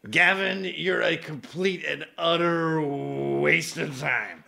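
slow, drawn-out put-on slur; imitated drunken insult. Drunk friend turning on Gavin, slow slurred condemnation. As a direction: stretch the insult out slowly, woozily. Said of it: "So he'd be like".

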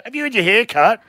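high fishwife voice; mocking Jane's voice. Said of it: "stop mocking my voice".

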